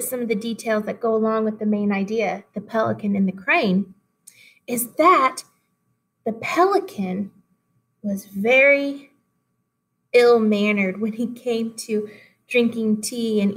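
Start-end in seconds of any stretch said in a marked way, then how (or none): none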